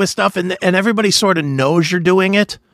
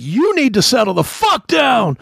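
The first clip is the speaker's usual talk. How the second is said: retold shout; 7 semitones higher than usual. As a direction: throw him down and yell at him to settle. Pinning the colonel down, yelling.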